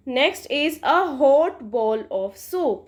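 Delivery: slow, careful example; careful reading. Shown as a demonstration. Teacher reading the example for students, slow. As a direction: read it out clearly for the class. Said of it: "इसको pronounce करना है आपने अच्छे से".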